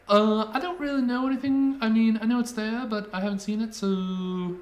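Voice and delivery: put-on high, loud teenage girl voice; a clerk brushing off questions; bored teen shop clerk.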